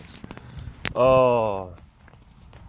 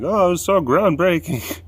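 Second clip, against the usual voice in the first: mock critic; 8 semitones higher, 5 dB louder than usual. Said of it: "anything like".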